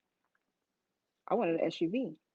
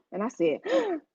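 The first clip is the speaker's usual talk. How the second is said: sharp high gasps of surprise; imitating a startled gasp; mock gasps; 7 semitones higher than usual. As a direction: gasp twice as if stunned. Retold gasps, high pitch.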